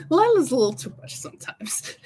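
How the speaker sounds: laughing whisper. Real, not acted. Laughing aside about Lila.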